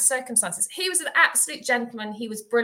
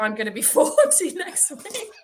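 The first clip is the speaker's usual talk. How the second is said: slow words broken by laughter; real laughter mid-sentence; laughing through it; 7 semitones higher than usual. Real, not acted. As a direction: fight back the giggles.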